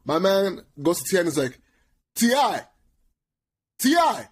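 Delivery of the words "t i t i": high, loud yelled call across a room; calling out a name. Retold or imitated. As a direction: imitate a friend hollering for someone's attention. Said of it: "the way he s-, he said he called T I".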